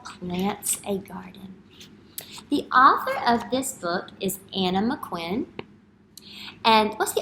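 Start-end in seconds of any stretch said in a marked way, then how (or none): 0.0-1.6 whisper. Shown as a demonstration. Whispering the title to class.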